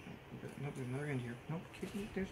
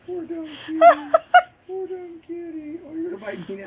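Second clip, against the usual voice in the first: higher, words squeezed through laughter; teary laughing pity; laughing to tears; 7 semitones higher, 14 dB louder than usual. Real, not acted. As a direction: talk while helpless with laughter. Laughing at cat in costume.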